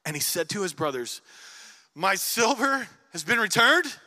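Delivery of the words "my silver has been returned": alarmed discovery. Acted. Brother discovers silver in sack.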